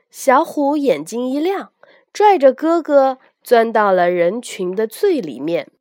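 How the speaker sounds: plain reading voice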